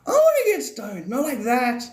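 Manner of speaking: high joking voice. Pretend. Joking about getting stoned.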